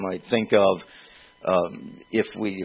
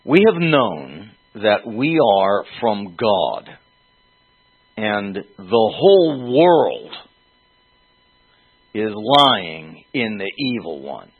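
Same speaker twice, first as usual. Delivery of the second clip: slow, louder solemn reading of the verse; scripture reading; 5 dB louder, slower than usual. Acted. Preacher reading First John to the congregation, slow and solemn. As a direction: read it out slowly, each phrase weighed.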